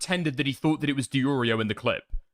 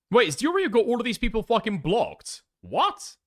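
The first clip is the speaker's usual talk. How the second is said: higher-pitched real disbelief; genuinely taken aback, voice raised in pitch; incredulous; 10 semitones higher than usual. Real, not acted. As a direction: react in astonishment to what you just found out. Reacts to surprising block list.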